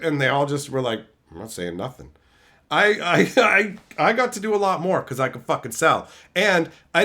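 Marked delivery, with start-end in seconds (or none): 1.3-2.1 low, soft mumbled backing down; sullen mumble. Retold or imitated. Coworkers backing down, low muttered surrender. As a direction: grumble it under your breath.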